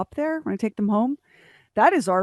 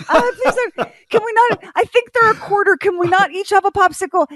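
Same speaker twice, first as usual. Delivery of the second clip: put-on much higher, louder, laughing little kid begging; pleading child; 13 semitones higher, 7 dB louder than usual. Little grandson begs his grandpa for his own popsicle, high whiny kid voice.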